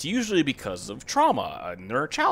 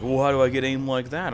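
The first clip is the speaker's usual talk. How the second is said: mildly mocking naive gamer, higher; mocking joker voice; 4 semitones higher, 5 dB louder than usual. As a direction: imitate a clueless player asking, lightly mocking. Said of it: "it was people joking".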